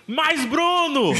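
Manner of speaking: high, drawn-out mock call of a name; imitated fan shout. Fans in a passing car calling out to Bruno, drawn-out high mock shout.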